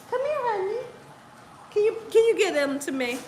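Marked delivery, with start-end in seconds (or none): 0.1-0.8 soft, high coaxing to a pet; coaxing puppy call. Real, not acted. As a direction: lure the puppy in sweetly. Calling puppy.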